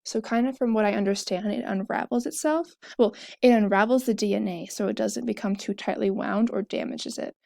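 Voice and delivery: normal talking voice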